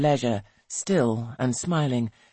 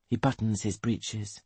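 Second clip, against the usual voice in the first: plain reading voice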